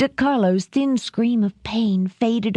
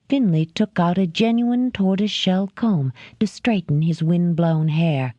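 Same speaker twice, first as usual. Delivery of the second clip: ordinary delivery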